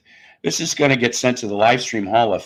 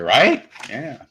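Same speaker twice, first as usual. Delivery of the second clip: ordinary delivery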